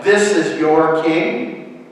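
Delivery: slow, loud, incredulous scorn; scoffing. A narrator's reading. Pilate mocks the accusers' claim about the prisoner, scoffing. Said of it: "scoffed Pilate".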